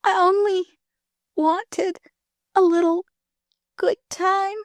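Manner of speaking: high, weak, slowing words; dying girl. Acted. Dying girl confesses she only wanted fun. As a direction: confess it with the last strength.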